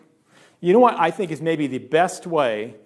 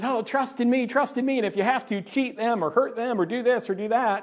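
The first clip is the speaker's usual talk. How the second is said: higher-pitched coaxing voice; money personified as tempter; 6 semitones higher than usual. Acted. Money tempting a believer to trust it, coaxing. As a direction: coax them, pitched up, as money talking. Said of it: "the money comes along and says".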